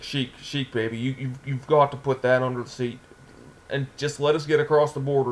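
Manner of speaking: ordinary talk, nothing marked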